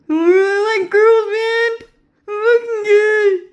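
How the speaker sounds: loud, high-pitched tearful wail; mock crying. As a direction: bawl it out in exaggerated fake sobs. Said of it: "They cry like that a lot".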